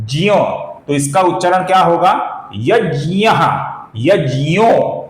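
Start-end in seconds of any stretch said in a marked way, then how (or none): 0.1-0.3 ज्ञ said as "jya", j into y; correct Sanskrit conjunct. Shown as a demonstration. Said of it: "सही संस्कृत उच्चारण है". 2.6-5.1 यज्ञः, यज्ञो with "jy", the O drawn out; Sanskrit conjunct demo. Shown as a demonstration. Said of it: "ऐसा इसका उच्चारण होगा".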